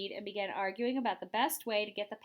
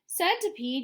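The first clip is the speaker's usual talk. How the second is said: higher called-out name; yell; 7 semitones higher, 4 dB louder than usual. A narrator's reading. James yelling to the centipede across the peach. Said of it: "yelled James".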